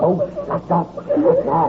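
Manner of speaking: put-on high, cackling drunken laughter through the words; drunken laughing. Drunk master laughing at the frightened maid, high and cackling. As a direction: laugh her off, too drunk to care.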